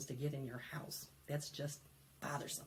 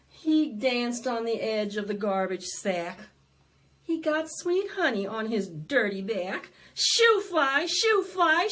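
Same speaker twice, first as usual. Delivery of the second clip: louder, bouncy rhyme reading; lively read-aloud; 6 semitones higher, 11 dB louder than usual. Acted. Librarian reading the fly book to children, loud and bouncy. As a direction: perform the book for a crowd of kids.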